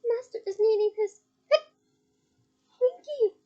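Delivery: high, pleading elf squeak; a little elf whimpering for her master; high tearful elf voice. A narrator's reading. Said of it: "whimpered the elf".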